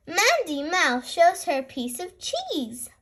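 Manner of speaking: usual speaking voice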